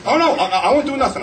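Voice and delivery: high, fast, laughing fake-innocent plea; mock pleading. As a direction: rattle it off like a guilty pal. Friend pleading in story.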